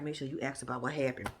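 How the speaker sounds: low, very soft murmur; hushed conspiratorial prompt; furtive aside. Retold or imitated. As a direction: slip it to a friend on the sly. Secret prompt to Kayla.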